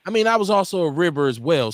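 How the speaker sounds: ordinary talk, nothing marked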